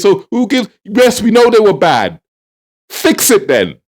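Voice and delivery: exasperated outburst, cutting off the excuses; fed-up retort, ending in a firm demand; amped up. Real, not acted. Vlogger answers government's blaming with a demand, amped up. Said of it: "Can you tell I'm amped up?".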